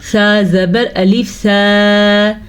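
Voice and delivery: slow "सा" with long a; spelling out slowly. Shown as a demonstration. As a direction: hold "सा" for alif madda. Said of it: "ये अलिफ मद्दा है". ("सा" लंबा)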